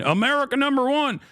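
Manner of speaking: hammed-up patriotic cheer; mock patriot cheer. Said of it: "some people are gonna turn around and say".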